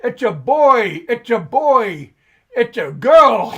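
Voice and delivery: loud, high, drawn-out proud holler; retold birth announcement yell. Father retelling how he announced his baby, yelled out. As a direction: yell it out to the room, breaking into a laugh at the end. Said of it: "I yelled out".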